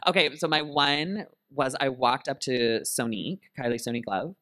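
ordinary talk

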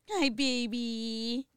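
slow cooing hello to a pet. Real, not acted. Greeting her dog.

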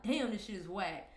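normal talking voice

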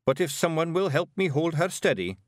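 high Scots voice, willing; earnest daft little warrior; dim Scottish Feegle. A narrator's reading.

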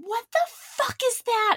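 very high, acted-out scream; screaming in alarm. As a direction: shriek it as if spotting a huge spider. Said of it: "and I scream".

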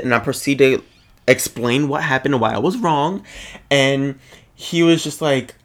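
ordinary talk

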